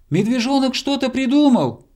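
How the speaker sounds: high, hopeful call; hedgehog shouting. A narrator's reading. Hedgehog telling hare help is coming, high shout. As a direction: shout the good news upward. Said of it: "крикнул ёжик".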